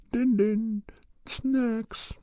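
very high sing-song dog call. Retold or imitated. Calling his dog Max home.

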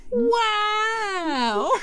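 high, stunned little wow; amazed ferret wow. Retold or imitated. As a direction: squeak out a dazzled wow. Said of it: "it's just standing there like".

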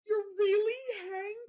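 high, crying voice, words breaking through tears; tearful and overwhelmed. Retold or imitated. Finally meeting Hank, high and crying with emotion. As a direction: gush it out, nearly sobbing. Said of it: "I get goosebumps".

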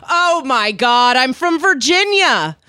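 high, loud ditzy voice; drunk stranger quote. Retold or imitated. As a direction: squeal it like a tipsy stranger. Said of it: "and goes".

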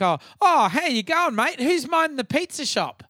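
high, cheeky heckler taunt; mock heckler voice. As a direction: needle the crowd in a high mocking voice. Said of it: "he'd say, like, things like".

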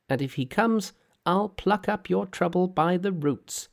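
high, perky monkey king voice; cheeky monkey hero. Acted. Monkey's voice in read story.

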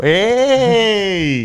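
sudden high "¡Ey!" yelp; mock yelp. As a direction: yelp like you got grabbed. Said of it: "me hizo así". (grito agudo imitado "¡Ey!")

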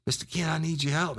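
high-pitched mock pleading; retold tearful begging; imitated crying plea. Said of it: "Motherfucker was crying".

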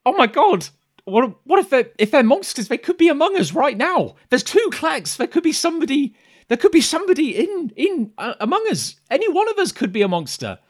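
a frightened voice at the back of the room; panicked. Acted. Villager at the back of the room shrieks in panic. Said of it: "panic kind of spreading across the room".

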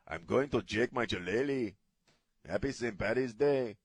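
hammed-up Mexican accent on Irish phrases. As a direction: roll the R's in a mock Mexican accent. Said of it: "with a Mexican accent".

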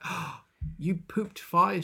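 high, slow mock wonder; hammed-up amazed question; awed amazement. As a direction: gasp it like an astonished crowd. Acted crowd amazed at fire.

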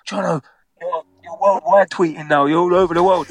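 high, excited mock cheer from the mates; a touch of giddy teasing. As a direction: gleefully tell him he's famous worldwide.

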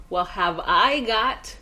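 slow, drawn-out salesman pitch; infomercial pitch. Pretend. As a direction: sell it like a TV pitchman.

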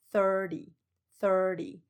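thirty said slowly, first syllable thir long and strong; stress on THIR. Shown as a demonstration. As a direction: punch thir in thirty, light ending. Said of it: "它的重音是在第一个音节". (thirty 重音在第一音节 thir，拉长)

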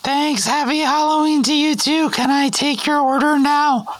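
put-on high, polite teen cashier; chirpy drive-thru kid behind the counter; fast food cashier voice. McDonald's cashier roleplay.

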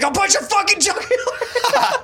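very high-pitched threat, laughing; mock enraged yell. Charlie attacking mall Santa, mock enraged screech.

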